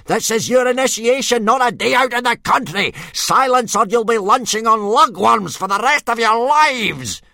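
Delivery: teacher yelling for silence. A narrator's reading. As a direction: shut them up in one word. Gobber shouting at recruits.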